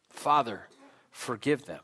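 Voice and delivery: subdued plea, fading away; hushed quoted plea. Retold or imitated. Preacher repeating Jesus's words to congregation, hushed. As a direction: repeat it low and soft.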